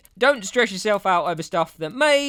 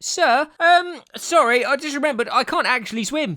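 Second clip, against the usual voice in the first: nervous excuse; 7 semitones higher than usual. Acted. Acted kid backing out to teacher.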